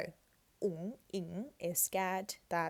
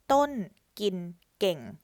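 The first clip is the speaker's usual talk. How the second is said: slow careful reading; 6 dB louder, slower than usual. Shown as a demonstration. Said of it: "reads the full sentence".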